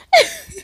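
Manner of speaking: squealing giggle; high laugh. Real, not acted. Laughing at her own refusals, high squeal.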